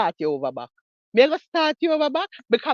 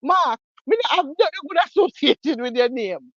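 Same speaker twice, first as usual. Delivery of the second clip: loud scornful dismissal; 4 semitones higher, 7 dB louder than usual. Real, not acted. Dismissing Mark loudly.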